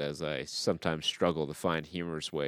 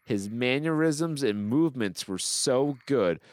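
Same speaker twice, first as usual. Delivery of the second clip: high-pitched, emphatic praise; gushing review read-out; 7 semitones higher, 3 dB louder than usual. Acted. Host reading praise of Austin Butler, hammed-up gushing. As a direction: declaim it like a superfan.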